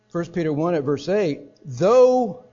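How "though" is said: emphatic opening word. Acted. Reading the verse aloud.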